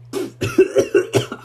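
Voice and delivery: harsh gag from the burn; gagging cough. Real, not acted. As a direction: choke and cough on the liquor. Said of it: "You heard me gag at that".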